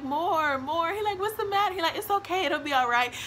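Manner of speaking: high, sing-song mock comfort; playful high comforting voice; mock comforting friend. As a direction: coo at her like a fake nurse.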